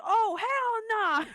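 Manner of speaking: slow, high, drawn-out hell nah; mock horrified no, stretched slow; slow drawn-out refusal. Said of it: "And he was like".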